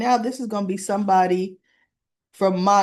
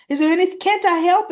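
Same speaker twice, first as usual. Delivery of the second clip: eager plea; 8 semitones higher, 5 dB louder, faster than usual. Retold or imitated. Retold eager offer.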